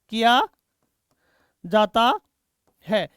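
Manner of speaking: each word set apart by a pause; halting, spaced-out classroom dictation. Real, not acted. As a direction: dictate word by word for students writing it down. Dictating words for students to write.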